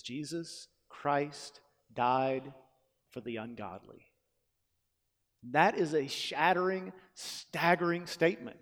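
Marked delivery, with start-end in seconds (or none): 0.0-4.1 very slow, quiet, weighty reading; true version, slowly. Shown as a demonstration. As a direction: let each word land slowly. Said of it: "It says".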